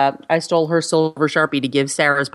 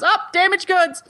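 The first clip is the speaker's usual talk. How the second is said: voicing the coworker; 13 semitones higher than usual. Coworker voiced in story.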